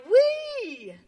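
high joyful squeal; frog cries wee. Acted. Frog shows off his dance to the pond, squealing. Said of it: "Frog cried".

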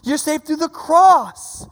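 slow, weighty declaration; preacher's deliberate stress; slow emphatic. Retold or imitated. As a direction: press every word home. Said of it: "And he's like".

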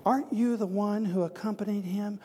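high-pitched maiden's inquiry; powerless maiden. Acted. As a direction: play a maiden questioning Peter. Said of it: "a maiden who has no power said to".

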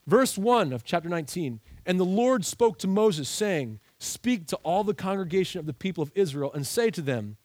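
usual speaking voice

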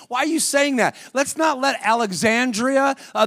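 ordinary delivery

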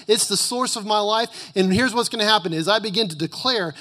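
high, loud emphatic preaching; booming motivational speech. Acted. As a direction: preach it hard and rising. Preaching at full force.

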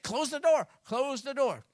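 high, loud, urgent command to shut the door; frantic order. Retold or imitated. Fleeing rebel orders the citizens, loud and panicked. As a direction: bark it out like a man on the run. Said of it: "He said".

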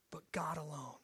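whispered end of a challenge; hushed whisper. Acted. Scribes asking who can forgive sins, whispering. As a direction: drop to an awed whisper.

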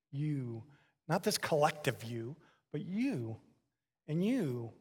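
normal talking voice

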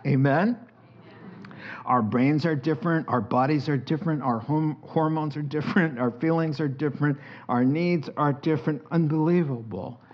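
ordinary talk

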